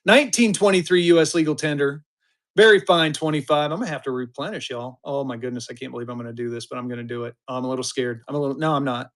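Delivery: ordinary talk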